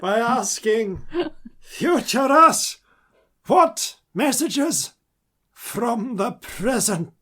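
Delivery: very slow, high, loud summoning; grand oracle voice. Pretend. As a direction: call out to the future like a seer. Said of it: "Who is this person?".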